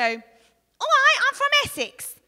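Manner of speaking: loud high Essex accent demo. Shown as a demonstration. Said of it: "think of different accents".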